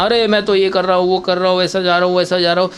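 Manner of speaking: fast, slurred gabble, words run together; rushed unclear talk. Shown as a demonstration. Acting coach showing how not to speak, fast gabble. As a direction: rattle it off too quickly to follow. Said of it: "ऐसा नहीं कि हमें fast बोलना".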